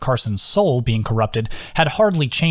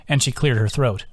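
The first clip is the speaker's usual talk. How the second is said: plain reading voice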